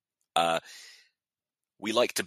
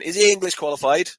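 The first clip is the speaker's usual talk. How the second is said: surprised; 10 semitones higher, 9 dB louder than usual. Retold or imitated. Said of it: "and I went".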